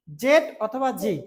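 জেড অথবা জি said slowly and clearly; slow careful. Shown as a demonstration. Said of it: "both are correct". (ধীরে স্পষ্ট করে)

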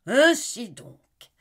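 slow, high, loud explosion of rage; furious outcry. Acted. Enraged husband confronting his wife, with a furious outcry. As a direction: burst out in fury. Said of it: "s'écria-t-il".

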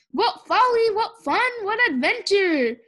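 high, loud, gleeful exclaiming; thrilled. A narrator's reading. Baby Lincoln delights in the adventure, thrilled. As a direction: revel in the wild ride.

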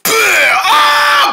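sudden high yell; jump scare shout. Pretend. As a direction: leap out with a loud high yell.

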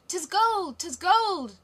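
high, loud shouts of greed; greedy cry. Acted. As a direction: robbers howling at the sight of gold. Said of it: "they cried".

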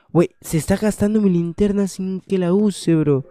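high, loud outburst of protest; indignant alarm. Real, not acted. Player whose flashlight drains without use, indignant alarm. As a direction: complain loudly at an unfair surprise.

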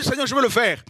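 high keen reply to the Lord; preacher replying to God's call, keen and high; eager servant. Retold or imitated. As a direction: answer God eagerly and quickly. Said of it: "Prêche ma parole".

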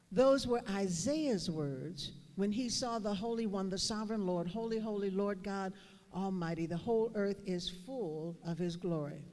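soft reflective pulpit voice; hushed sermon. Acted. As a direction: draw the room in close with a quiet voice. Quiet reflective sermon passage.

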